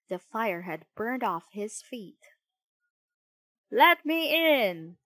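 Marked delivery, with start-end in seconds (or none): 3.7-5.0 loud, high, drawn-out call; raised-voice demand to enter. A narrator's reading. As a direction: shout through a closed door. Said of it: "called Geppetto".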